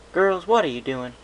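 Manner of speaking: loud, high stern big-sister demand; scolding. Acted. Applejack scolds the hiding fillies, loud and stern. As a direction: call them out sharply.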